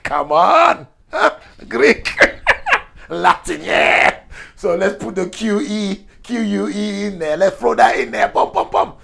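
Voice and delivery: loud, high, laughing enthusiasm; excitable mock pitch, voice shooting up; Italian guy. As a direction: play an exuberant Italian pitching ideas. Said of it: "the Italian came along and said".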